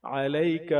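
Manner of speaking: علیک with a throaty ع from the throat; correct take. Shown as a demonstration. Teacher models the correct علیک. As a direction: say علیک with a full ain. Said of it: "عین اگر صحیح آواز نکالتے".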